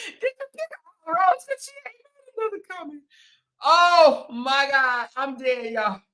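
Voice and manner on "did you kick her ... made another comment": squeaky, laughed-through rush; cracking up. Real, not acted. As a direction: squeeze the words out through helpless giggles. Repeats comment while cracking up.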